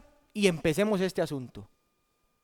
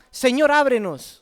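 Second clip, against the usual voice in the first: loud, raised cry to be let in; acted urgent call; shouting; 4 semitones higher, 6 dB louder than usual. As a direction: shout it as at a shut door. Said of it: "no solo gritaban".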